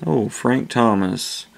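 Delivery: usual speaking voice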